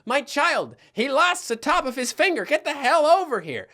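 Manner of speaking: high, panicked plea for help; frantic mom calling for help. Retold or imitated. Mom flagging down cars, pleading frantically for help. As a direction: call out like a desperate mother. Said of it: "My mom is trying to flag people down".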